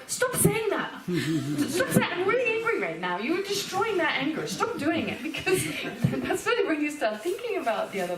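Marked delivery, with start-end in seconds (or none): 0.1-5.2 mock angry protest. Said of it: "And you go".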